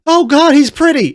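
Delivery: high, loud swoon; gushing. Acted. Reactor voices a smitten Lucy, gushing. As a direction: gush it loudly in a high voice.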